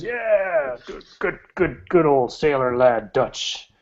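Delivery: high hearty shout of approval; cheering sailor crowd. Acted. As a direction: toast him like drunk sailors. Tavern patrons cheer Dutch.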